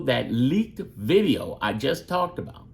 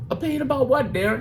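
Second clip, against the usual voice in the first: 1 mock viewer; 11 semitones higher, faster than usual. Mock viewer asking Darren.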